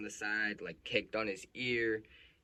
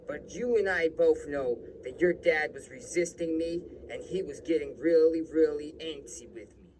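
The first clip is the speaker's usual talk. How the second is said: higher, louder imitation of an insistent cop; self-justifying cop; 5 semitones higher, 9 dB louder than usual. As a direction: protest innocence forcefully in the cop's voice. Retold cop insists he is blameless.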